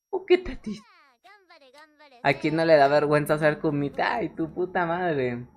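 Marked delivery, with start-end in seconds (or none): 0.1-0.9 put-on much higher squeaky baby voice; high squeaky baby talk over a sad drawing; squeaky pity. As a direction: squeak it like a cartoon mouse. Pitying the crying rat drawing.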